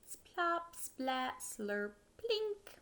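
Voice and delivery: playful popping sound words; bouncy onomatopoeia. Acted. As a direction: pop each sound out.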